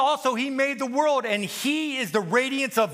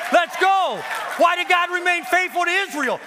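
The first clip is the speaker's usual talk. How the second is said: ordinary talk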